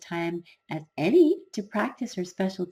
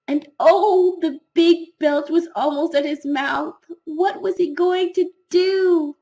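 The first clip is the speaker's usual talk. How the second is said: higher, louder breathless alarm; rising panic; 8 semitones higher, 5 dB louder than usual. A narrator's reading. Narrator panicking as the whole school watches Norman, rising alarm. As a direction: pile on the dread with each line.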